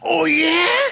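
very high, indignant retort, hammed up; triggered mock outrage. Boyfriend suddenly triggered by her insult, mock outrage. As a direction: fire back like a stung movie boyfriend. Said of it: "he's triggered".

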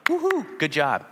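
squeaky high woohoo; a playful mock cheer for a rival. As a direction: whoop it like a cheerleader. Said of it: "Paul's going".

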